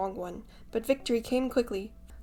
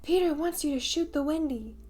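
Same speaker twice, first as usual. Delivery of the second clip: high, louder fairy voice ringing out; ringing fairy cry; 7 semitones higher, 5 dB louder than usual. A narrator's reading. Jealous fairy calling down to the boys, ringing. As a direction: ring out the lie brightly. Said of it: "Tink's reply rang out".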